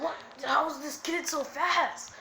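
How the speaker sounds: high-pitched astonished question; amazed villain. Acted. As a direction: exclaim in acted disbelief. Acted villain amazed at speed.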